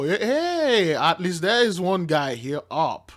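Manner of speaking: ordinary talk, nothing marked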